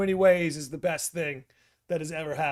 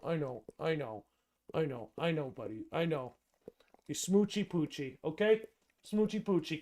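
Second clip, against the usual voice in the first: soothing dog; 5 dB quieter than usual. Real, not acted. Streamer calming his whining dog, soft soothing coo. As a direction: hush and comfort the pup.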